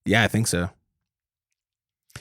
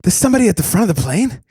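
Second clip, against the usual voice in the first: higher, hammed-up tough-guy voice; mock Mark Wahlberg; 7 semitones higher, 3 dB louder, faster than usual. Two friends riffing, he hams up a movie star on the plane. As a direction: play a clueless action star mid-hijack.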